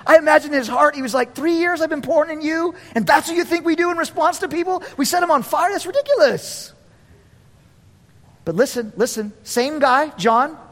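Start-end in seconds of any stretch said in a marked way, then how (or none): 1.4-6.7 raised pitch, incredulous and climbing; exasperated disbelief. Acted. Jesus to disciples wanting fire, exasperated disbelief. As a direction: scold them in astonished frustration.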